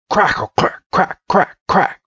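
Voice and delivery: high staccato crackle noises; popping mock sound effect; imitated crackling. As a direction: make fire crackle with your voice.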